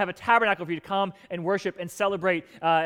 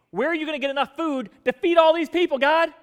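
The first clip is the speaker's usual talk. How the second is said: higher, louder disbelief; incredulous challenge; 9 semitones higher, 7 dB louder than usual. Retold or imitated. Preacher voicing doubt at God, loud and incredulous. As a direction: demand an answer in disbelief.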